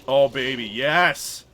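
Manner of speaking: loud cheering yell; excited cheer. Real, not acted. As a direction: cheer them on loudly. Cheering allies in fight.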